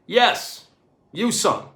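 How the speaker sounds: brash American officer voice; American accent. A narrator's reading. Astronaut to cleaner, brash American accent. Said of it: "in an American accent".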